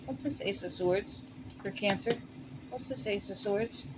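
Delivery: very soft, low murmur to oneself; hushed asking. Real, not acted. Tarot reader asking the spirit guides about a card, hushed.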